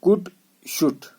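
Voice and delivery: कुड, शुड slowly, both without L; slow demonstration of कुड, शुड, L silent. Shown as a demonstration. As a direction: model कुड, शुड ending straight on D. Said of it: "पढ़ना ऐसे है".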